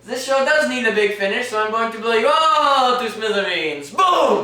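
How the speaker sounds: loud, high, building to a huge boom; villain threat building to a shout. Acted. Performer threatening to blow the audience up, ending in a loud high boom.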